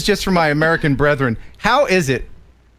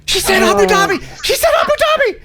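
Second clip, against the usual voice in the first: excited laughing exclamation; 4 dB louder than usual. Real, not acted. Excited about cohost's voice.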